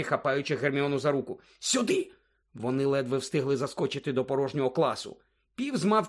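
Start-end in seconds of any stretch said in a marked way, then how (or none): 1.6-2.1 urgent hushed order. Acted. Urgent order while hiding.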